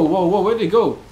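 ordinary talk, nothing marked

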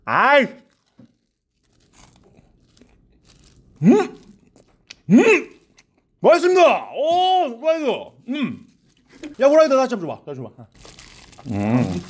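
chewing while talking; speaking between bites; mouth full. Real, not acted. Seen 0: Puffed pursed cheeks after bites; still chewing chicken while speaking.

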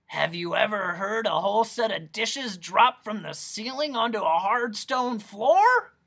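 loud, high hoarse questioning. A narrator's reading. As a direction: rasp it out loudly, rising at the end. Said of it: "a hoarse voice inquired".

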